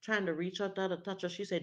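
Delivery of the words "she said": ordinary delivery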